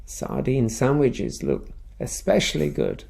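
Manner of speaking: usual speaking voice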